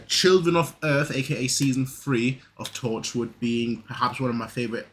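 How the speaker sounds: ordinary talk, nothing marked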